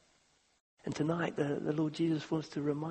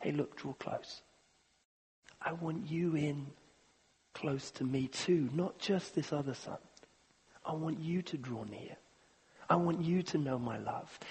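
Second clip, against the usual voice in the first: tender pleading; 6 dB quieter than usual. Acted. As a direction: coax him near gently. Father pleading with son.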